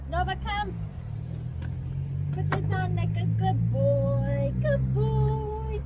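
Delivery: high, slow sweet talk to the dog; high pet voice; dog praise voice. Real, not acted. Talking to dog Nova.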